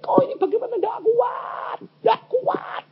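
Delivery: high, wailing mock sob to God; hammed-up weeping cry; imitated crying. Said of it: "wuh, nangis".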